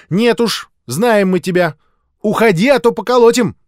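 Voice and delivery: high, loud threat; a little girl warning off an intruder; defiant child. A narrator's reading. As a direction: chase him off indignantly. Children drive older boy away.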